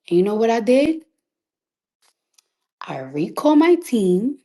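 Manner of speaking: ordinary delivery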